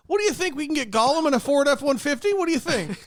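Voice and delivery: high-pitched mock studio boss; greedy studio executive. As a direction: play a cash-hungry producer.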